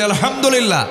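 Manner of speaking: "আলহামদুলিল্লাহ" called out high and loud. Real, not acted. Preacher leading the congregation in a loud response. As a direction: raise the crowd with a big "আলহামদুলিল্লাহ". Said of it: "জোরে বলি".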